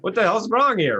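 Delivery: high, loud exasperated cry; frustrated outburst. Retold or imitated. Coach recalls his own exasperated cry at failed affirmations. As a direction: throw up your hands in exasperation. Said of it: "So I was so frustrated".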